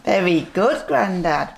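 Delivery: ordinary delivery, nothing marked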